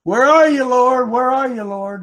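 mock-alarmed cry to the Lord; acted alarm. Preacher voices a sinking believer calling for the Lord, alarmed. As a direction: wail it like a sinking disciple. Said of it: "you're gonna start looking at your circumstances around you and say".

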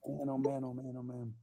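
breathless, gasping for air; winded after preaching hard; out of breath. Real, not acted. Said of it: "Let me catch my breath here".

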